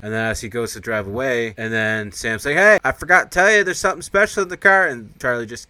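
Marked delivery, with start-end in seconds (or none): 2.5-4.9 loud, high-pitched acted holler; calling after someone. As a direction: shout after a departing car. Calls after departing driver.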